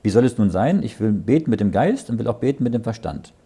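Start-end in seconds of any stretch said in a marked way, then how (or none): none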